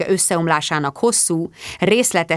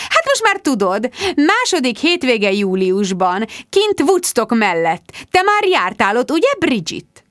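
gushing hostess; 6 semitones higher, 4 dB louder than usual. A narrator's reading. Rebecca's gushing voice.

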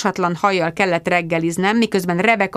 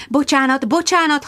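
gabbled apology; 8 semitones higher than usual. Acted. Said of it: "hadarta Sharon".

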